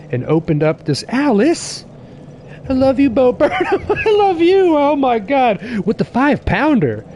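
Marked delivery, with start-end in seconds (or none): 1.1-7.1 overjoyed shout-out to the donor; thrilled squeal. Real, not acted. Thrilled at a donation.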